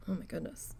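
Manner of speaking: ordinary talk, nothing marked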